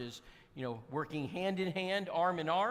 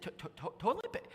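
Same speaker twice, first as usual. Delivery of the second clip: stammering disbelief; 2 semitones higher, 5 dB quieter, faster than usual. Retold or imitated. Disbelief at toilet paper question.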